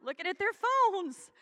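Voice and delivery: high, mock astonished look around; playful mock surprise at the crowd; mock amazed wow. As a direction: act shocked at the squirming room. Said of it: "I'm like".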